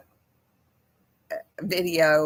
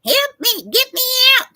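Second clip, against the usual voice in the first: squawky high parrot cry for help, much higher and louder; 7 dB louder than usual. Retold or imitated. Retelling a viral video to viewers, high squawking parrot impression. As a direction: mimic a trapped parrot begging to be let out. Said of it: "and the parrot's going".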